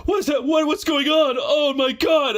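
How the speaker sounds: high alarmed shout; shouting plumber. Retold or imitated. Plumber hearing the rattling tub, high panicked shout. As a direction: yell it up high in comic panic.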